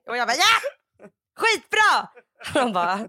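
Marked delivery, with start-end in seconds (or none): 0.4-2.1 high, loud whoop of approval; hammed-up, shrieky eagerness; mock enthusiasm. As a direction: imitate blindly confident excitement. Imitates over-eager friend answering.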